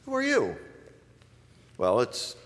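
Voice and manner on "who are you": curious friendly question. Shown as a demonstration. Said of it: "So if I were to ask".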